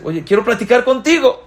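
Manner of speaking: alto y fuerte, el rey que llama; voz del rey que busca hablar; rey llamando. Retold or imitated. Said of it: "el rey dice". (el rey le dice al súbdito que quiere platicar, alto)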